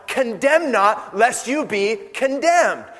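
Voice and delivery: slow, deliberate scripture quote; measured recitation of the verse; slow emphatic reading. Shown as a demonstration. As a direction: lay each word down slowly. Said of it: "it's translated in Matthew seven".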